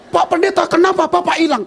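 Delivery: high, loud scolding question; angry scolding. Retold or imitated. Lost tour member scolding the pastor, high loud angry. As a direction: snap at him as if he got lost. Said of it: "dia marah duluan".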